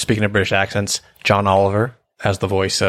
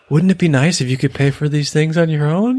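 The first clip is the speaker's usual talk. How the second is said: gentle coaxing in a higher pitch; kind, soft-footed suggestion; 9 semitones higher than usual. Retold or imitated. Bob gently suggesting Gail pay her own way, kind and soft-footed. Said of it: "soft-footed".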